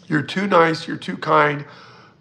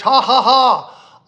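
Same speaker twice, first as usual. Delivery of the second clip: loud, high, slow belly laugh; big laugh; 7 semitones higher, 7 dB louder, much slower than usual. Real, not acted. Laughing at the viewer's bug joke, big laugh.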